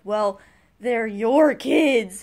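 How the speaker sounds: slow, loud blaming parent; mock parent argument. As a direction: shove the blame, drawn out and loud. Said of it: "and it's like".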